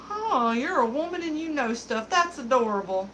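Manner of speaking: high, cooing mock condescension, easing down at the end; patronizing old-timey guy. Bartender mimicking old-timey guys amazed she knows stuff, mock-patronizing. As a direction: imitate a man fawning condescendingly. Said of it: "old-timey kind of guys are, like".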